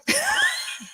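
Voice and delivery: real high-pitched burst of laughter. Real, not acted. Cracks up at own tongue twister.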